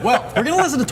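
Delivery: talking through a laugh. Real, not acted. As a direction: laugh it out quickly. Laughing while explaining.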